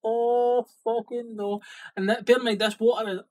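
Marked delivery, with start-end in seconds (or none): 0.0-1.6 slow, drawn-out dismay; mock dread. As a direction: let the panic sink in slowly. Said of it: "I was like".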